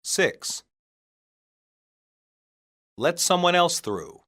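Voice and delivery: ordinary talk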